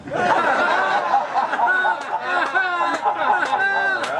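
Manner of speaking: long high helpless laugh; high wheezing laughter. Real, not acted. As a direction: lose it completely at the punchline. Laughs at "six hours.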